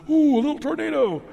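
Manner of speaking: high, loud mock-excited "Ooh"; mock excitement. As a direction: oversell a tiny dust devil as thrilling. Mocking excitement over dust.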